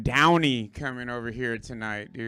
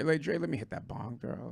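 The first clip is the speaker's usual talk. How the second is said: ordinary delivery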